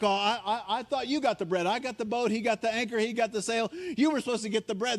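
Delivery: lightly voiced panicking disciples; panicky disciples. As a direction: blame each other in a fluster. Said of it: "They're like".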